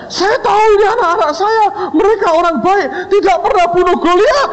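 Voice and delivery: very high, squealing comic insistence; mock frantic defence. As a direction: vouch for the students in hammed-up panic. Lecturer frantically defends students.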